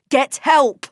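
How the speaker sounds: high, loud yell; yelling at the man to fetch help. A narrator's reading. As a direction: yell the order. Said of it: "I yelled".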